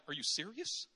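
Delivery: incredulous, mock-stunned; disbelieving God. God in heaven reacting to our thoughts, incredulous. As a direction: react like you can't believe it. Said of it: "the Father's got to be in heaven like".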